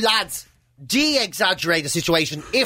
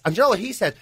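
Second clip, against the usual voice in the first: ordinary talk, nothing marked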